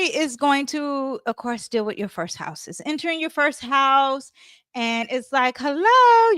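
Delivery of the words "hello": high, loud, drawn-out hello; emphatic hello. Acted. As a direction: call it out high like a wake-up. Acts out a racing, direct mind.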